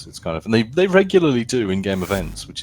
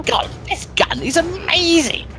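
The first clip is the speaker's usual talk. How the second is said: Frankie on PC. Acted. Impersonating a YouTuber playing Battlefield, high excited squeal. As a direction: squeal like an amazed gaming YouTuber. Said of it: "do a Frankie on P C".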